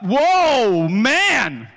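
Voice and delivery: loud amazed whoa; surprised. Acted. Adam waking up to find a woman, amazed. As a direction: exclaim in wonder.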